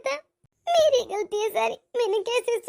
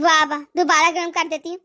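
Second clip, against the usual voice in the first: lower, sneering wah wah; sarcastic mockery; 6 semitones lower than usual. Mother-in-law mocking the bride's offer to reheat, sneering sarcasm. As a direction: throw her offer back at her scornfully.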